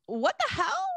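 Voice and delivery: high-pitched, incredulous question; mock disbelief without extra volume; retold bewilderment. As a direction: imitate a baffled stranger, pitched right up. Stranger startled at being named.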